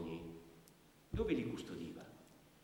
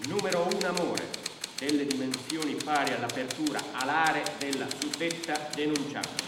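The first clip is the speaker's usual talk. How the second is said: higher and much louder formal dictating; official dictation; 7 semitones higher, 10 dB louder than usual. Acted. Brigadier dictating the stolen love as an item, loud. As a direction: bellow it into the record.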